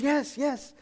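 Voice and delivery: high, quick eager yes; lightly voiced eager friend; eager reply. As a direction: chirp it up like an excited man. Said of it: "He goes".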